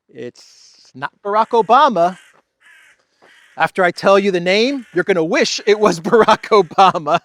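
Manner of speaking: loud, high, teasing build-up; teasing reveal. Real, not acted. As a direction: hype up the reveal with a laugh.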